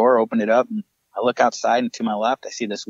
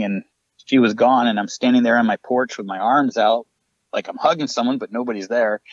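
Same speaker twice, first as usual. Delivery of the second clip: ordinary delivery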